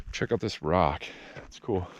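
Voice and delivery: breathless after the climb; panting between words; out of breath. Real, not acted. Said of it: "I'm so out of breath".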